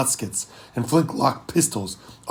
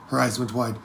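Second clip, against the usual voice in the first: ordinary delivery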